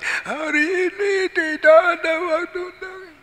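very high, sustained mock wail; wailing child. Child echoes father again, very high mock wail. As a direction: bawl it on one high, crying note. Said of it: "Dia ikut lagi".

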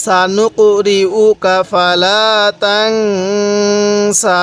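তানসা with a held nasal ন, very slow; nasal ghunnah. Shown as a demonstration. Teacher demonstrating ghunnah, very slow. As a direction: hum the ন in তানসা. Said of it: "গুনাহ করব".

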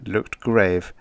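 ordinary delivery

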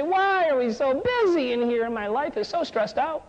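slow, high, drawn-out complaint; mock whining. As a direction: whine it like an overworked employee. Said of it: "did not whine and say".

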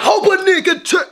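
high-pitched abrupt yelp; sudden outburst. Pretend. Vlogger breaking his mock prayer, high yelped punchline.

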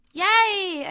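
high, loud yay; excited cheer. Real, not acted. Celebrating a grown banana with her viewers, loud excited cheer. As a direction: cheer like you won.

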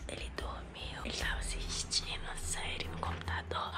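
hushed whisper so he won't wake. Real, not acted. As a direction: whisper very softly beside a sleeper. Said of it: "eu já tô falando baixo porque ele tá dormindo".

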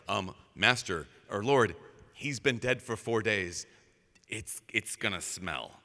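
low, slow, reluctant warning; hesitant mourner. Pretend. People warning Jesus about the smell, low and slow. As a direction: drop low and hedge awkwardly.

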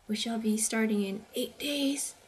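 normal talking voice

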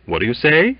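anxious repeated question; anxiously. Acted. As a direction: press him nervously. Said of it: "inquired Waite anxiously".

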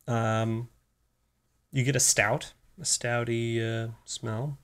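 talking while sniffing drink; speaking into a glass. Real, not acted. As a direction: voice muffled by glass rim. Seen 0: Sniffs beer glass held at nose and mouth while speaking.

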